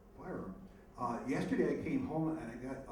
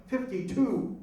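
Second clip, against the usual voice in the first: higher, louder shout of an inmate calling a number; yelled call; 9 semitones higher, 8 dB louder, much slower than usual. Retold or imitated. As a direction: yell it out across the cellblock. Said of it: "somebody yells out".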